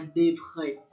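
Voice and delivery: "devre" with a guttural French R; throat R. Shown as a demonstration. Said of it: "da garganta".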